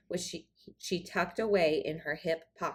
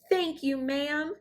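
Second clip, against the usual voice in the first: grateful cry; 7 semitones higher than usual. Acted. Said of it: "she cried".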